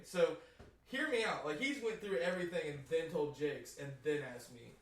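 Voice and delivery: normal talking voice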